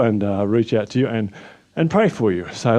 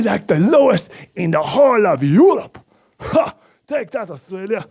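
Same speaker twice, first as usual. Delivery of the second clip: put-on higher-pitched, excitable Slovak-accented man bragging; a proud Eastern European accent, voice pushed up high; 6 semitones higher than usual. Anton gloats about Slovakia's covid numbers in a high Slovak accent.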